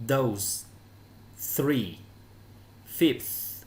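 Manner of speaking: Those, three, fifth, each apart with clear th; isolated falling words for learners; th pronunciation demo. Shown as a demonstration. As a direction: model voiced th in Those, voiceless in three and fifth. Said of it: "hai cái từ này đọc giống nhau".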